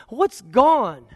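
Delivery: ordinary delivery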